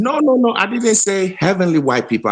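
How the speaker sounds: high-pitched, urgent objection; indignant protest. Real, not acted. Caller correcting the host who misquoted him, indignant protest. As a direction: cut in high and insistent to deny it.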